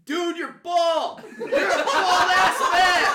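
slow, high, very loud yell; shouted comeback. Acted. Heckled fan hitting back at rival fan, shouting. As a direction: blast the insult back at him.

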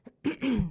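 loud dry cough to clear the throat. Real, not acted. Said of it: "I have a frog in my throat".

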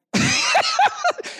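a high burst of laughter. Real, not acted. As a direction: laugh out at the irony. Pastor laughs at the story.

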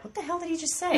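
confused friend. Retold or imitated.

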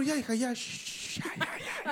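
put-on nervous hush to the little girl, rising high; mock-scared father shushing his daughter. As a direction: soothe the child while frightened yourself. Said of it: "Con miedo".

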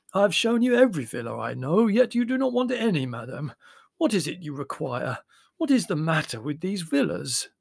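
high, loud foreign agent at his wits' end; exasperated pleading. A narrator's reading.

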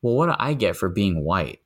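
ordinary talk, nothing marked

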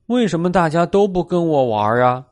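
high, mildly hurt kitten voice; a touch of childlike complaint, pitched high; lightly voiced sulky little cat; upset little cat. A narrator's reading. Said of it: "小猫很生气，也很难过".

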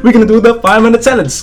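high, quick, gleeful announcement; happy excitement. Real, not acted. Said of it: "Why you happy?".

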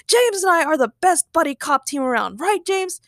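high-pitched indignant counter-boast; indignant shout. Acted. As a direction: outdo the rival, then seek agreement. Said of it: "Ray shouted".

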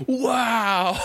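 mock astonishment; stunned wow. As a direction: act out being taken aback. Acting out stunned reaction to retort.

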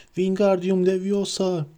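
high, slow spell cry; cried out spell. A narrator's reading. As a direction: call out the spell in panic. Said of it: "haykırdı".

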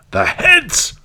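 high, loud outraged cry; angry cry. A narrator's reading. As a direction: cry it out in anger. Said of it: "cried he".

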